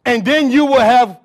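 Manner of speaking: loud scripture proclamation with drawn-out words; full-force preaching. Acted. Preacher reading the promise to the congregation, full-force preaching. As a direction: ring out the promise, stretching key words.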